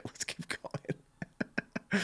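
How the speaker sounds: hushed whisper, slow. Real, not acted. Reactor whispering to keep the video going. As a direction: whisper to move on.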